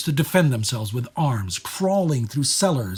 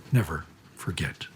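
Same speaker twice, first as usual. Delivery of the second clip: softer, lower, near-whisper; hushed solemn close; 6 semitones lower, 6 dB quieter than usual. Acted. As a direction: let it sink to a grave hush. Solemn refrain closing a passage.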